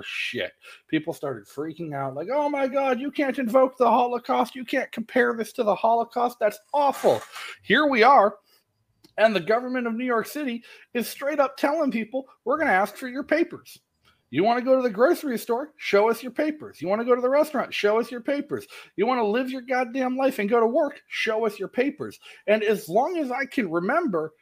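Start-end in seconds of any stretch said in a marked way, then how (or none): 2.3-7.2 high, whiny mock outrage. Mimicking offended online critics in a high whine. Mocking outraged internet people. 12.5-22.2 high, sneering bureaucrat voice; mock official demand. Mocking city officials demanding papers, high sneer.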